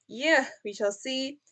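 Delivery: ordinary talk, nothing marked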